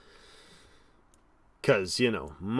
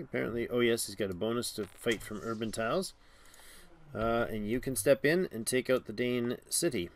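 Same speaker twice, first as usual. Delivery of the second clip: normal talking voice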